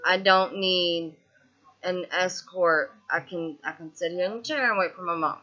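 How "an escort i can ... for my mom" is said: ordinary talk, nothing marked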